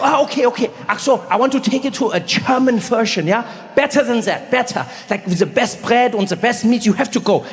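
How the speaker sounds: put-on eager host with a German accent. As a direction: imitate a German pal, rising at the ends. Acts his German friend Rolf.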